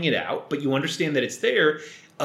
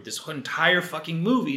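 normal talking voice

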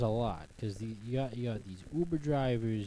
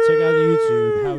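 shrieked, very high and much louder; high squeal; 14 dB louder than usual. Acted. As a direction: squeal it out like a signature noise. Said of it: "I love when you do that noise".

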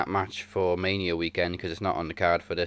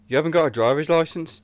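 raised, loud incredulous impression; higher, louder wrestler imitation; Pete Dunne quote; 9 semitones higher, 5 dB louder than usual. Fan quoting the wrestler's surprise at the missing licence, imitated raised voice. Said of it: "When Pete Dunne went".

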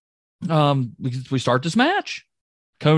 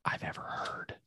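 whisper; 19 dB quieter than usual. Pretend. Building up the big news.

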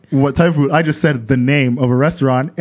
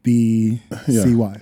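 careful, slow letter-by-letter spelling; 4 semitones lower, much slower than usual. Real, not acted. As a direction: spell each letter out slowly. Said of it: "Could you even spell that word?".

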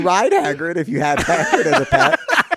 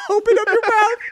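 put-on baby talk to bird; 5 dB louder than usual. Feeding bird with wand.

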